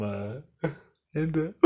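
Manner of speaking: normal talking voice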